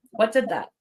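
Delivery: ordinary delivery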